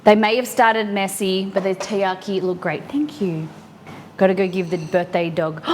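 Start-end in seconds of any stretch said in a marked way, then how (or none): none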